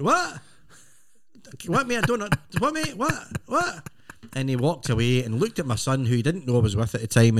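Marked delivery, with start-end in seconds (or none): 0.0-3.9 high, confused repeated "What, mate?"; bewildered what. Retold or imitated. A jet-lagged traveller baffled by a stranger at security. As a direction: keep asking "What?", lost and baffled. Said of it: "so I went".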